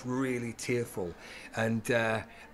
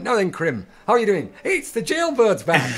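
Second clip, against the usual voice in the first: loud, high teasing call-out; mock jeering greeting; 9 semitones higher, 10 dB louder than usual. [name] greets actor on stage, jeering loudly. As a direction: hail him loudly, building to a gleeful high taunt.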